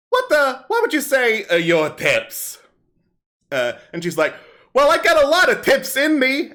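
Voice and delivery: bawdy kobold comic on stage; kobold comedian. Acted. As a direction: perform it like a street comic working a crowd. Kobold comic tells bawdy joke.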